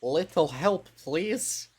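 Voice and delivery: first try at a sultry huntress asking for help; Huntress voice attempt. Shown as a demonstration. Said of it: "Huntress voice".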